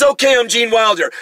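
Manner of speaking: loud mock surrender; dad giving in. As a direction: throw up your hands. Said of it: "He says".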